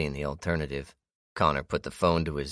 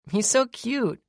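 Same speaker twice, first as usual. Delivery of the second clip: put-on little girl voice; 5 dB louder, slower than usual. A narrator's reading. Child sees fawn.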